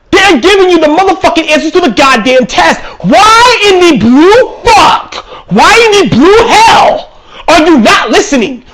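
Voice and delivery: exasperated screaming rant; furious shout. Real, not acted. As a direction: blow up at them in disbelief.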